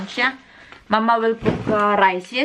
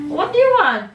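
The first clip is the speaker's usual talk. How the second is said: raised question; 10 semitones higher than usual. Real, not acted. Mother asking her toddler again in a high, raised voice. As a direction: ask again, higher, to get her attention.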